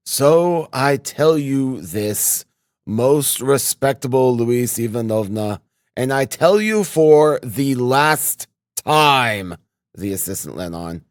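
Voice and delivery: slow, emphatic warning; stern threat. A narrator's reading. Assistant threatening the landlady, stern. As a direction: lay down the last word slowly.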